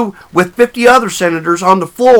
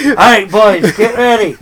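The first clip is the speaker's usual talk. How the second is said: louder, barked orders to the crew; pirate captain; 4 dB louder than usual. Pretend. Joking pirate captain ordering his crew below deck, louder. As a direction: play a ship's captain rallying the boys.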